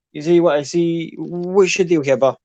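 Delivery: sizing you up in Irish; cocky Irish accent. Acted. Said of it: "Your Irish accent is fantastic".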